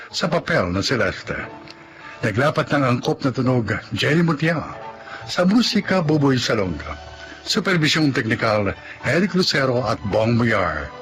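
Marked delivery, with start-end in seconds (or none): none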